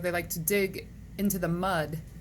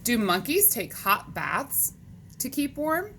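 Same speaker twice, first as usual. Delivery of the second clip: lively, higher-pitched picture-book reading; read-aloud to children; 5 semitones higher, 3 dB louder, slower than usual. Acted. Teacher reading animal questions to boys and girls, animated read-aloud. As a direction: read it to kids with big pitch swings.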